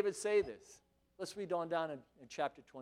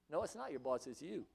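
ordinary talk

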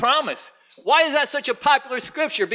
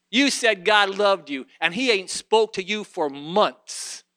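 normal talking voice

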